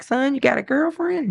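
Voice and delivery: high, teasing question to her son; teasing mom. Retold or imitated. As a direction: playfully needle him about a girlfriend. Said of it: "I'm like".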